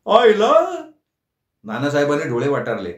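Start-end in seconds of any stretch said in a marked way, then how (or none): 0.1-0.5 high, loud startled echo; jolted, repeat it in disbelief; shocked echo. A narrator's reading. Nana scandalized by Shekhar's slip of tongue.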